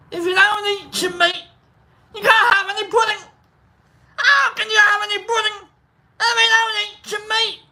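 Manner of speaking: high, hollering Scottish schoolmaster; Scottish accent. Acted. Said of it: "This is where I get my Scot".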